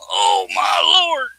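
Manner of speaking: slow drawn-out exasperated cry; exasperated shout. Real, not acted. Straining to move heavy piece.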